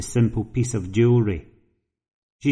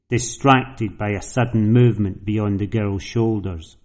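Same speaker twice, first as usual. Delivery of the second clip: plain reading voice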